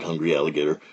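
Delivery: usual speaking voice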